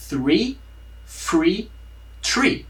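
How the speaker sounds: three with th, free with F, tree with T; three free tree contrast. Shown as a demonstration. Teacher contrasting three, free and tree. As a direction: contrast the three words' first sounds. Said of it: "Essa é a diferença".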